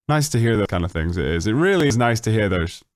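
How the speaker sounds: ordinary delivery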